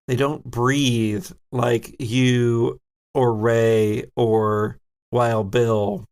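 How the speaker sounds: ordinary delivery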